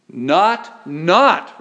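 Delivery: "not, not," stacked and punched loud for emphasis; emphatic repeated not. Acted. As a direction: pound the word "not," twice. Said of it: "when the Greeks piled on the nots, it is to emphasize it".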